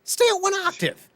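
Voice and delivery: high, disbelieving mock outburst; incredulous outburst. Fan mocking a wrestler's promo, high incredulous outburst. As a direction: squeal out your disbelief at the promo. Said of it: "and I'm like".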